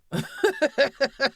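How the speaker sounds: loud high cackle of disbelief; loud high laugh at the short deliberation; high burst of laughter. Real, not acted. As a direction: break into a big shrieking laugh.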